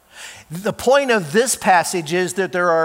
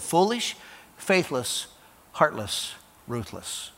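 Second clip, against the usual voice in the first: fading list; 2 semitones lower, 7 dB quieter, slower than usual. Shown as a demonstration.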